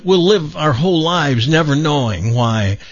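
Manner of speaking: ordinary talk, nothing marked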